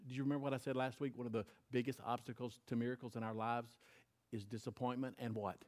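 ordinary talk

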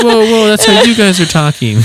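high, hammed-up panicky backpedalling; alarmed protest. Scared kid protesting to the armed barber, mock-alarmed. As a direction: imitate a startled kid pleading innocence.

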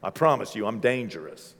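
low, soft hippo voice; hippo talking. Pretend. Hippo boasting it is dangerous, low and quiet. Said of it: "it's like".

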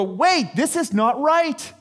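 high, slow dawning protest; sudden alarm. Retold or imitated. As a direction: stop short and object. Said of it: "we have to go".

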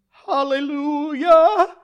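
long, high, loud hallelujah; jubilant praise shout. Acted. Naomi praising God at the news, jubilant shout. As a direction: cry out praise, stretching every syllable. Acted praise, arms lifting.

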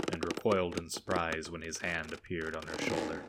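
ordinary delivery, nothing marked

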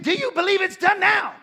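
high, loud demanding question; shouting the question again; preacher shout. Retold or imitated. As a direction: press her for the miracle. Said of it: "I said".

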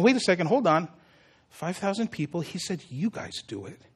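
soft, dawning realisation fading away; musing to oneself. Acted. Peter thinking back on five thousand, musing softly. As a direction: think aloud very quietly.

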